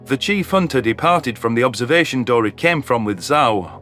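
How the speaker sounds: plain reading voice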